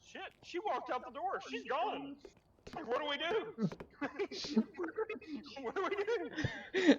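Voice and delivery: high-pitched imitation of a panicked kid; mock panic. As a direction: retell it high, as if at a loss. Classmate's retold panicked report.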